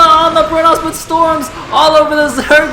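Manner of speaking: high, loud climactic esports call, easing at the end; full-voice battle commentary, slowly coming down; shouted play-by-play. Real, not acted. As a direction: shout the carnage in drawn-out phrases.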